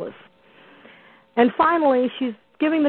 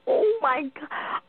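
slow, hushed, higher-pitched mock disbelief; retold shock; 6 semitones higher, 6 dB quieter, slower than usual. Caller retelling her reaction to the pig video, stunned. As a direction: draw it out softly, re-enacting the shock. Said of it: "I was just like".

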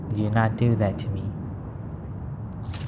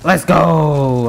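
loud, raised battle cry; excited shout; 5 semitones higher, 4 dB louder, much slower than usual. Real, not acted. Gamer urging on a kill, loud excited shout. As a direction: shout it out in the thrill of the fight.

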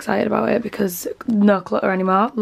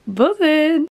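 louder, higher burst of joy; excited; 6 semitones higher, 5 dB louder than usual. Real, not acted. To viewers about her progress, an excited burst. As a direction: pop it out, thrilled. Pleased with the cleared wardrobe.

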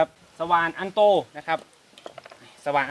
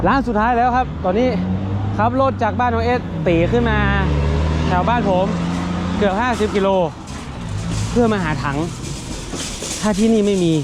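excited; 5 semitones higher than usual. Real, not acted. Vlogger to viewers at the last shop, excited and higher. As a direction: pump up the desperate search.

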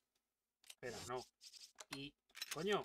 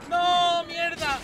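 loud, high curse; furious at losing again; frustrated shout; 12 semitones higher, 15 dB louder, much slower than usual. Real, not acted. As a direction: yell in exasperation. Dying again in game.

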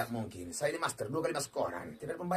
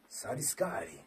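soft whispered disbelief; awed whisper; 3 semitones lower, 6 dB quieter than usual. Real, not acted. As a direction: breathe it in awe. Awed at the win. (bisikan kagum)

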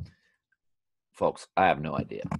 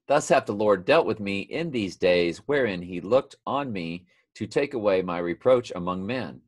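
raised, emphatic King James reading; scripture reading; 4 semitones higher, 5 dB louder than usual. Acted. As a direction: read the verse out with weight. Reads scripture aloud.

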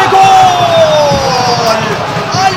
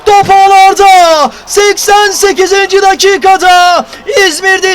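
screaming football commentator; 4 semitones higher, 8 dB louder than usual. Real, not acted. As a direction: roar the goal call at full voice. Goal scored, commentator erupts.

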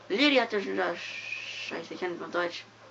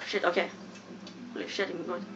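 ordinary talk

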